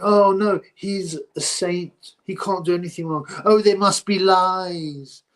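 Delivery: high, drawn-out mock defence; mocking normie voice. Mocking the fans who defend Ali, high and drawn out. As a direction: sneer at the naive fans. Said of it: "people think".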